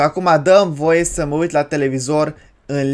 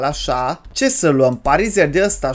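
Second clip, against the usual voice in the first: ordinary talk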